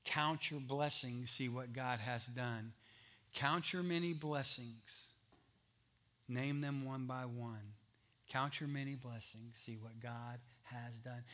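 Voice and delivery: slow, soft, low recitation; old hymn recital. Retold or imitated. Preacher recalls an old congregational song, slow hushed recital. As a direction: count it off slowly and quietly, phrase by phrase. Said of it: "It's an old song we used to sing".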